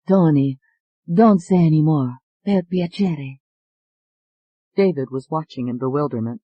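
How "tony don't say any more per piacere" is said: put-on gentle, pleading Italian accent; soft Italian accent. Alette warning Tony in a soft Italian accent. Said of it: "soft voice with an Italian accent".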